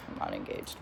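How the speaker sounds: quick confession under the breath; whispered aside. Real, not acted. Aside admitting the lie.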